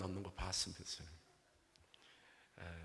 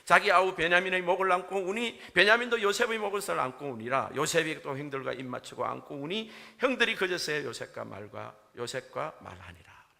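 loud, raised-pitch Bible reading; scripture reading; 7 semitones higher, 13 dB louder than usual. Real, not acted. Pastor reads the Genesis verses to the congregation, loud scripture reading.

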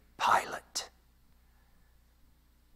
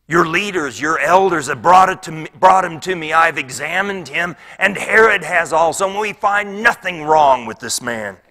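loud, raised voice of Pilate arguing; insistent plea; 6 semitones higher, 11 dB louder than usual. Acted. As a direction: plead forcefully over a noisy crowd. Pilate pleads with shouting crowd.

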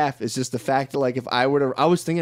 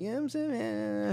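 higher, softer and slow, a put-on girly whine; mock girlfriend voice; 8 semitones higher, 9 dB quieter, slower than usual. Boyfriend mimicking girls worried about weight, soft high whine. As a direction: lightly parody a girl fretting about weight. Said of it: "and they're like".

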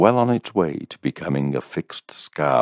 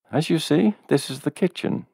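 high, chirpy tour-guide woman; lightly voiced landlady; 13 semitones higher than usual. A narrator's reading. Landlady showing the kitchen, chirpy higher voice. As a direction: point out the obvious brightly. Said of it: "she says unnecessarily".

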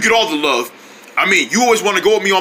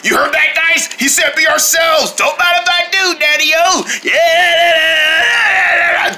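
giddy shouted cheers; excited shouting; 11 semitones higher, slower than usual. Acted. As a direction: whoop it up like freed kids. Kids thrilled to be themselves.

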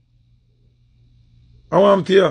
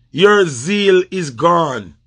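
very slow, each word weighted; slow scornful pronouncement to a rival; slow verdict; 2 semitones lower, much slower than usual. Real, not acted. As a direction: hand down the verdict slowly.

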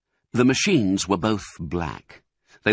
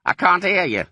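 loud deaf complaint; 11 semitones higher, 5 dB louder than usual. Acted. Deaf Bean can't hear.

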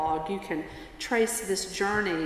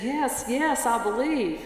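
higher-pitched, eager yes; desperate eagerness; 4 semitones higher than usual. Acted. Dangling tourist assures the voice from above, desperate eagerness. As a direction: answer in mock desperation.

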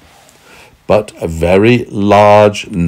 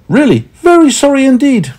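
high little apologetic voice; small voice; 5 dB louder than usual. A narrator's reading. Meddle apologising to the angry man, small voice. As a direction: apologise in a tiny high-pitched voice. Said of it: "said Meddle in a small voice".

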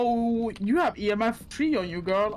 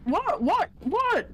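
startled whats; 10 semitones higher, slower than usual. Real, not acted. Startled by ghost event.

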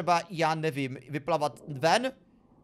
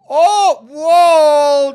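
loud, high shocked yelp; a sudden cry of shock at the screen; startled cry; 8 semitones higher, 17 dB louder than usual. Real, not acted. Gamer shrieks at a weird scene: startled cry.

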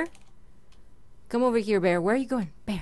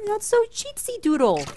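playful whine; 11 semitones higher than usual. Real, not acted. Complaining skeleton hits underwater.

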